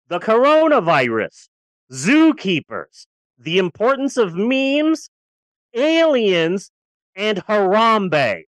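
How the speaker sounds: slow, punchy showman's list; dramatic announcing. Acted. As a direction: unveil each item like a grand reveal. Dramatic listing of film's absurd subjects.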